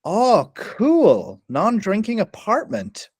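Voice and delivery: high, drawn-out earnest "cool" voice; fully serious. Retold or imitated. Newcomer sizing up a young apartment, fully serious. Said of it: "fully serious".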